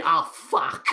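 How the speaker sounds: groaning disappointed curse; mock dismay. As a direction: act gutted at the unwanted good news. [name]'s dismay at being voted through.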